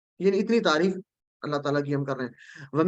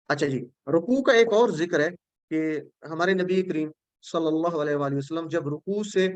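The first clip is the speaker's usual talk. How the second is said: ordinary delivery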